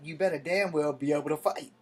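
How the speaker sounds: usual speaking voice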